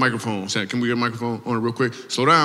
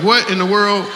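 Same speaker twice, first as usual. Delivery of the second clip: mock surprise; 8 semitones higher, 4 dB louder than usual. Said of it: "I was like".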